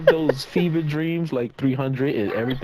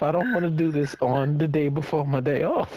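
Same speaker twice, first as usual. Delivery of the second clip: ordinary delivery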